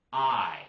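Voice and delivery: "I" dragged out long and loud; stretched, unblended "I", said emphatically; drawn-out Southern vowel. Shown as a demonstration. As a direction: drag out "I" the Southern way. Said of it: "We'll drag it out".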